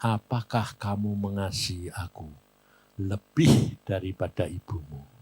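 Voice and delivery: low, softened divine question; suara Tuhan. Retold or imitated. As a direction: lightly voice God, low and measured. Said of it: "Saudara tahu jawabannya Tuhan". (suara Tuhan yang rendah dan pelan)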